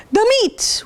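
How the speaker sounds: high hammed-up "The meat!"; mock roar. Crew roaring for meat at the table, high mock roar. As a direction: roar it back like hungry pirates. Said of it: "we all roared".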